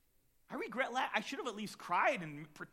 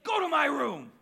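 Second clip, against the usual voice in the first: much louder and higher, she's had enough; mom's angry shout; 10 semitones higher, 14 dB louder than usual. Retold or imitated. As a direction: yell the order again, furious. Mom repeats order, angrier.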